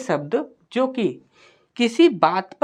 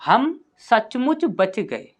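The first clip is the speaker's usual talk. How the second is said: slow dictation; 4 semitones higher, much slower than usual. Shown as a demonstration. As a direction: read out slowly, word by word, slightly higher. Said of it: "इसके बाद है आपका".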